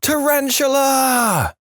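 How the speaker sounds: high, drawn-out scream; shrieked alarm, stretched out. A narrator's reading. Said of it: "throwing back the covers and screaming".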